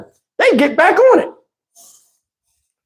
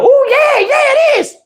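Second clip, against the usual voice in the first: mock daughter. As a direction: mimic the daughter agreeing happily. Daughter agreeing in cold water.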